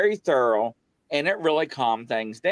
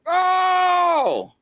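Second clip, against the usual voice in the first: high, loud yelp of surprise; mock a startled gasp; retold shout of shock, pitched way up; startled cry; 3 dB louder than usual. He echoes their startled cry.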